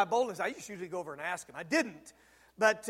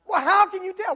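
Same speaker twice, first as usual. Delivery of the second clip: much higher, louder, insistent; pressing question; 11 semitones higher, 12 dB louder than usual. Retold or imitated. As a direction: push him for the answer. Pressing the friend.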